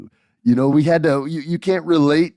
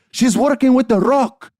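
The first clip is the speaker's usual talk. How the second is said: put-on very high, loud accusation in Puerto Rican accent; heavy Puerto Rican accent, outraged and piercingly high; upset Puerto Rican grandma; 6 dB louder than usual. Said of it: "she's really upset, and she has this heavy Puerto Rican accent".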